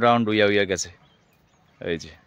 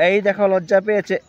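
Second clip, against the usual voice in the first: excited showing; 9 semitones higher, 4 dB louder than usual. Real, not acted. Showing plant shrinking.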